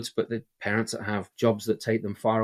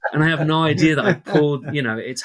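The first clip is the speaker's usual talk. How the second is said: laughing, louder and higher; 6 semitones higher, 8 dB louder than usual. Real, not acted. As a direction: laugh through the words.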